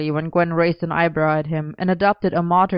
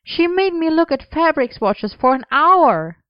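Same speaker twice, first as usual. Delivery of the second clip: high, aggrieved whine; whining complaint; 10 semitones higher, 4 dB louder than usual. A narrator's reading. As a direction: complain like it was torture, pitched high. Merlin keeps grumbling, voiced by narrator.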